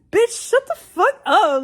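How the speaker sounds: high, loud, drawn-out retort; exasperated shout. Retold or imitated. Acting out a retort to a nagging coworker, exasperated shout. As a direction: let the fed-up outburst rip, slowly.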